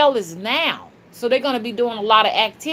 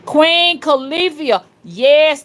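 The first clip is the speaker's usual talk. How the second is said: calling out; 8 semitones higher, 6 dB louder, slower than usual. Real, not acted. Crying out praise for the queen.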